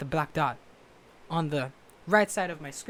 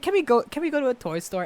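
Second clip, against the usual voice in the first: higher mock-childish plea; pleading kid; 8 semitones higher, 4 dB louder than usual. Vlogger imitating himself begging friends, childish plea. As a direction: beg like an excited little kid. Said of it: "I was like".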